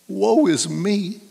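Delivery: drawn-out, theatrical lament; mock lament. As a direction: draw it out in hammed-up self-pity.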